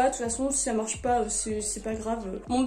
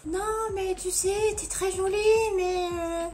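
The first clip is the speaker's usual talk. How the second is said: lightly put-on higher voice; hesitant turn-down in a higher voice; higher, mock-awkward reply; 6 semitones higher than usual. Trying out [name]'s reply.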